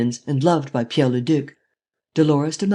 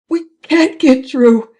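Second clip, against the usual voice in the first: panicked shriek; 10 semitones higher, 8 dB louder, slower than usual. A narrator's reading. Panicking passenger.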